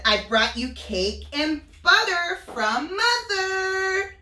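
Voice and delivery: put-on bright high voice of a vain little girl; sing-song Little Red bragging; high girlish Little Red. Wolf voicing Little Red telling of her trip to Granny.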